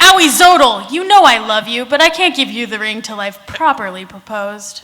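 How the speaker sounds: loud, hammed-up heroine line read; Daring Do voice. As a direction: belt out the heroine's vow, half laughing. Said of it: "Wait, no, I wanna try".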